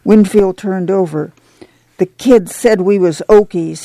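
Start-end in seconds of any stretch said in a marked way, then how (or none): none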